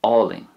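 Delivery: álainn opening on awe; Munster and Connacht álainn. Shown as a demonstration. Teacher models southern and western álainn as awling. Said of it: "pronounced more or less the same in Munster".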